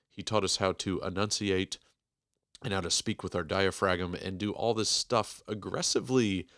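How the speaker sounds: ordinary talk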